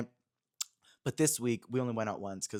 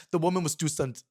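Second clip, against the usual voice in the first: meme voice quote; 4 semitones higher than usual. Pretend. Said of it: "What was it?".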